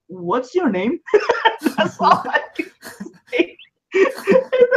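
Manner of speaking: high, breathless laughter over the words; laughing. Real, not acted. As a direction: laugh helplessly at your own words. Laughing retelling own stupid question.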